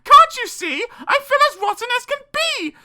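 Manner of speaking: high, loud, indignant wail; high-pitched hammed-up tantrum; crying out. A narrator's reading. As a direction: screech it as a fed-up Cinderella. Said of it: "cried Cindy".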